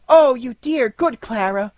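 put-on high, loud girlish exclamation; fervent cry. A narrator's reading. Marie praising Clara for catching the hero, high fervent cry. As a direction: burst out with high, gushing gratitude. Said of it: "cried Marie".

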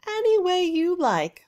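high, loud laughing answer. A narrator's reading. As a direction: toss it off with a laugh. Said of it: "laughed the Weird Witch".

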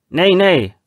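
high, loud protest; called out. Acted. Franske Pete shouting in a quarrel. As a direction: shout it down the boat. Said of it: "ropade han".

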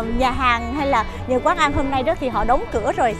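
ordinary talk

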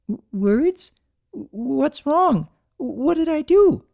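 high, stuttering, rising alarm; a timid mare fearing trouble; panicky stammer. Acted. Fluttershy fearing Big Mac's words, high stammering panic.

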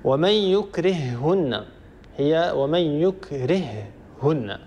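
يكرههن said slowly with the two has merged into one doubled ha; slow recited example. Shown as a demonstration. Teacher reciting يكرههن to show merged ha. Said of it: "هاء وهاء".

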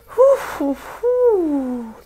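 releasing tears with loud breaths; loud emotional exhale. Real, not acted. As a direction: puff out the emotion loudly. Emotional exhale after crying.

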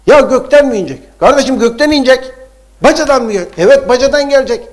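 loud, high-pitched insistent preaching; heated rhetorical back-and-forth, voice pushed up; emphatic exclaiming. Acted. Heated rhetorical objection and answer.

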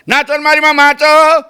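high-pitched, exasperated uncle's outburst; mock tirade, pitched very high; worked-up uncle. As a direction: imitate an uncle heckling the cinema screen. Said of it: "અમારા કાકા ઊભા થઈ ગયા".

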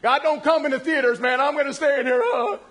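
high, loud comic fretting; mock panic. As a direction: play someone terrified of being left behind. Acting the man scared in theater.

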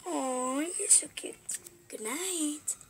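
very soft, hushed goodnight; tender mom impression. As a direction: coo very softly over a sleepy baby. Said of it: "My mom babysit babies will be like".

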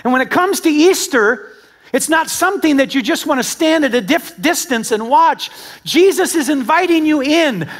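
high, loud, rhythmic sermon call; full-force preaching. Acted. Preacher urging the church to come in, full-force preaching. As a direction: drive the repeated invitation home.

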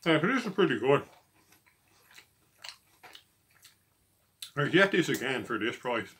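talking with mouth full; eating while speaking; chewing food. Real, not acted. Seen 0: Bite taken at -3; mouth closed, likely chewing during speech.

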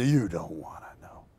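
low, whispered mock-judge reply; Simon Cowell. Pretend judge warning the insistent host, low whisper. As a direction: sink into a dark whisper. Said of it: "Well, Simon, tell me".

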